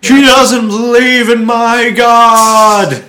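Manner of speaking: high, loud and very slow outraged yell; mock zealot yell. Mocking a religious protester, a hammed-up outraged yell. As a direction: drag it out like a ranting zealot.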